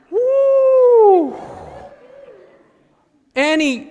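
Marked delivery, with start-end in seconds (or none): 0.1-1.3 high shrieking laugh-whoop. Real, not acted. Preacher cracking up at the child's reply, high whoop.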